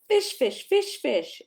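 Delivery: high, loud, eager calling of fish, fish; a child bursting with excitement over the fish; excited call. Acted. Kay excitedly calls out fish.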